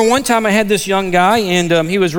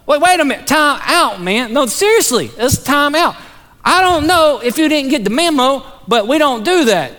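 retold incredulous interruption, pitched well up; disbelieving rebuke; 6 semitones higher than usual. Pastor stopping his jailed church member short, incredulous. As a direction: call a halt in high-pitched disbelief.

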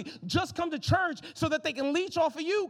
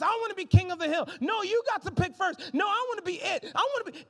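put-on higher, louder kids squabbling; 7 semitones higher, 3 dB louder than usual. Toddlers on the playfield fighting to go first, higher and louder. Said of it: "they're fighting against each other".

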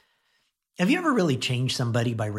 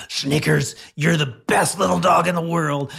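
louder, gruff scowl with kind words; 4 semitones higher, 6 dB louder than usual. Shown as a demonstration. Pastor scowling nice words at his dog Snickers, gruffly. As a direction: snarl the praise like a threat. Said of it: "I could scowl and say".

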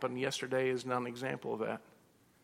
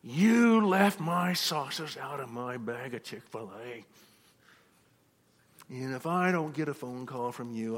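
louder, slow, imitating an irate customer's complaint; angry customer; 5 dB louder, slower than usual. Pastor imitating an angry Chick-fil-A customer complaining, loud and slow. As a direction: play the furious customer, leaning on every word. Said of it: "He was so mad".

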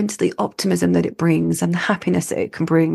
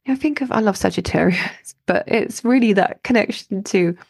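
ordinary talk, nothing marked

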